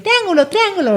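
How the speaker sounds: high sing-song app voice. Retold or imitated. App naming shapes for a child, high sing-song. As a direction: chirp it like a kids' app. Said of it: "la aplicación va a decir".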